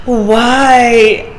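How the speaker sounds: daughter's hammed-up why; dramatic why. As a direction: wail it like a joke tragedy. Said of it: "becomes dramatic".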